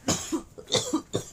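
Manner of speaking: real coughing fit; harsh cough. Real, not acted. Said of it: "sorry guys, one second".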